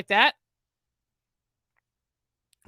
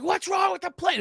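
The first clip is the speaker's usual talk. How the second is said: mock irate passenger; 10 semitones higher than usual.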